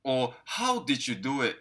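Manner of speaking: slowly, every word clear; slow model question. Shown as a demonstration. Teacher suggesting a follow-up question, slow and clear. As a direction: ask it slowly for learners. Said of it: "っていうような質問".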